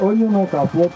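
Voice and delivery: normal talking voice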